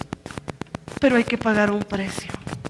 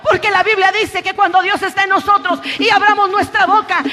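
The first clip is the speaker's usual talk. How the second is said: full-force preaching, urging the congregation on; prédica enérgica; 9 semitones higher, 5 dB louder than usual. Real, not acted. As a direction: challenge them in Jesus' name with raised, driving voice. (predicación enérgica y exhortativa)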